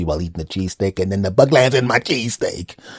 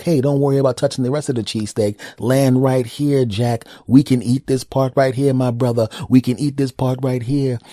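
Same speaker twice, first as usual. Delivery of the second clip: higher, hyped-up pal voice; bug talking to buddy; 5 semitones higher, 4 dB louder than usual. Acted. First bug telling second bug where to land, higher chummy voice. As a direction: egg your buddy on, punching the words. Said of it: "first bug said to the second bug".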